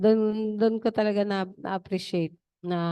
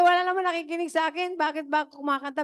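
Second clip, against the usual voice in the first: higher, fast, sulky grumble about nobody listening; a singer griping to herself, higher and fast; mock complaint; 7 semitones higher, faster than usual. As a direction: imitate a fed-up singer whining quickly. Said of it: "Sabi niya".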